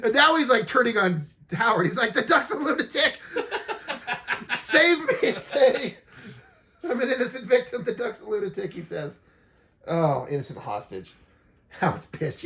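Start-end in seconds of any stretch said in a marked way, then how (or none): 2.1-8.7 laughing mock plea. Quoting pleading character, laughing.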